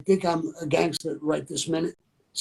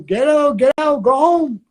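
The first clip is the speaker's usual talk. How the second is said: high, loud retold yell; high-pitched mock screaming; imitating a woman's scream; 9 semitones higher, 7 dB louder than usual. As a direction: scream it down like an angry neighbor from an apartment. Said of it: "scream down".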